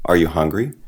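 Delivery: ordinary talk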